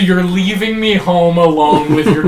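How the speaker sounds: high-pitched mock complaint; hammed-up exasperation, starting high; nagging wife. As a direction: scold like a put-upon wife. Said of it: "you were like".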